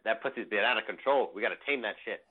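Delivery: usual speaking voice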